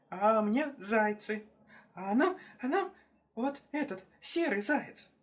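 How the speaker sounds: high, squeaky-pitched testimony; high little voice, halting; small animals' voices. Acted. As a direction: pipe up timidly, drawing it out. Small animals passing the blame along.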